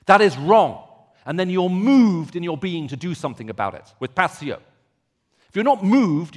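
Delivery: loud, rising preacher's fervour on Jonah's outrage; impassioned preaching. Acted. Preacher to congregation on Jonah's rage, loud and fervent. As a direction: build the outrage, pressing hard.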